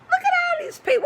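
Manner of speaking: high squeaky mocking voice of the complaining woman; mocking entitled lady. Mocking the complaining woman in the clinic lobby, high squeaky voice. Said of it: "She wanted to know".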